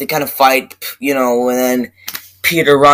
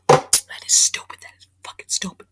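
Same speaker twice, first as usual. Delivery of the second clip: whispered aside; 7 dB quieter than usual. Real, not acted. Muttering to himself after the rant, whispered disgust. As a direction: hush it to yourself in disgust.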